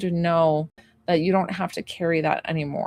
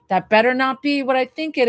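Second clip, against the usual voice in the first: a mom's warning, pitched a bit higher; lightly imitating a mom telling her teen off; mock scolding mom; 6 semitones higher, 4 dB louder than usual. Mom warning her son about a tattoo, mild mock scolding. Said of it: "getting on him, being like".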